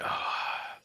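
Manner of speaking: slow relieved sigh; long exhaling sigh. Acted. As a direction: let out a long tired breath. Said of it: "a long kind of exhalation sigh".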